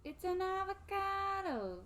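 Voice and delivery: high, slow, drawn-out meme voice; Vine quote. Retold or imitated. Friend riffing on Colorado with a Vine, high drawn-out quote. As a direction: stretch it out high like the viral clip.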